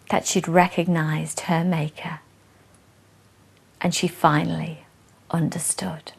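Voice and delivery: soft whispered close; whispering the final revelation; whispered ending. Acted. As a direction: let the last lines fade to a whisper. Hushed ending of poem.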